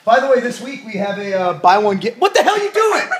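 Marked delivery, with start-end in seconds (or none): 2.2-3.1 high, loud laughing break; startled laughing outburst. Real, not acted. Interrupted mid-promo by his wife, high loud laughing surprise. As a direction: burst out mid-sentence in surprise.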